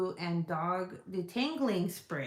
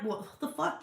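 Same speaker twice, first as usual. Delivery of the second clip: high-pitched retold disbelief; mock incredulity; 8 semitones higher than usual. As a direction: act out being taken aback. Repeats retold reaction to cologne.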